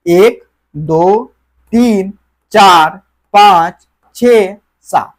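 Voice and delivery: slow, deliberate counting for the class. Shown as a demonstration. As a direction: count slowly, each number apart. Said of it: "count भी एक बार कर लेते हैं".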